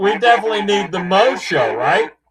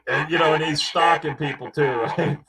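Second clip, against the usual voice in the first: normal talking voice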